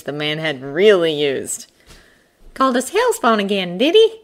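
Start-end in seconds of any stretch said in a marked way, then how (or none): 2.5-4.2 high-pitched, dry hare voice. A narrator's reading. Hare asks the bear about the insults, dryly. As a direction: pitch it well up, drily indignant. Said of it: "the hare asked dryly".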